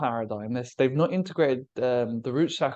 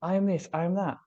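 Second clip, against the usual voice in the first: higher-pitched mock list of identities; imitating identity claims; 6 semitones higher than usual. Speaker mimicking a split psyche, imitating identity claims. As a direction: rattle off each claim in a lifted mimicking voice.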